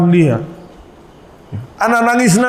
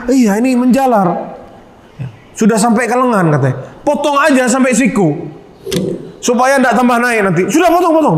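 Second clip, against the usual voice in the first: higher, insistent healer voice; healer's order; 7 semitones higher than usual. Retold or imitated. Healer telling the thug to cut to the elbow, raised and insistent. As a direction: push the amputation urgently, pitched up.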